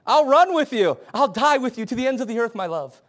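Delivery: high, loud mock-romantic vow; impassioned pledge, acted. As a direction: act out a devoted wife's vow, falling away at the end. Wife's imagined devoted pledge.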